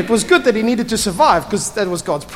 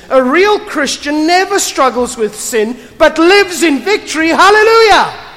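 building to a hammed-up Hallelujah, higher and louder; mock triumphalist; 6 semitones higher, 4 dB louder than usual. Preacher mocking triumphalist Christians, hammed-up shout. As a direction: parody the victory preacher, rising to a shout.

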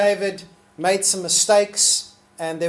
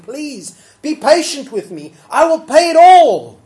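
higher, pleading, louder toward the end; begging; 9 semitones higher, 12 dB louder than usual. Acted. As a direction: plead with the master, rising to a loud cry. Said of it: "begged him".

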